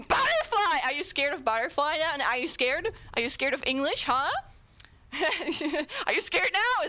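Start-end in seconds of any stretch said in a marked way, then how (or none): 0.0-0.8 high mock-menacing shout; sudden scary yell; screamed. Shown as a demonstration. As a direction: scream it to scare them. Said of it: "I can scream".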